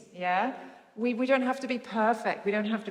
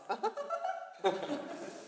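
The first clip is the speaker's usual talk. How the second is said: high laugh; 6 dB quieter than usual. Real, not acted. Laughter after flirt demo.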